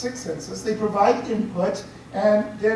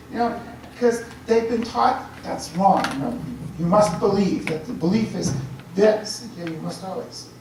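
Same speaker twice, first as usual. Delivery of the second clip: usual speaking voice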